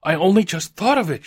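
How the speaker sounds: high, loud, overwrought; hysterical. A narrator's reading. Wife kissing her husband after a sudden idea, hysterical. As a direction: burst out with it hysterically. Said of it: "she said hysterically".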